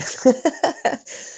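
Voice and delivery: high, voiceless burst of laughter; short wheezy chuckle; breathless laugh. Real, not acted. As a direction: laugh at yourself, mostly on breath. Laughs at own dodgy accent.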